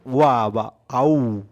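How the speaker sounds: "ওয়া" as 'wa', "আউ" as 'ow', drawn out slowly; demonstrated letter sound. Shown as a demonstration. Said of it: "ও এর উচ্চারণ". ("ওয়া" আর "আউ" ধীরে টেনে উচ্চারণ)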